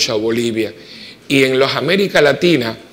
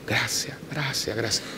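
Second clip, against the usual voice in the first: faint murmur; 2 semitones lower, 13 dB quieter than usual. Retold or imitated. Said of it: "lo único que me decía era".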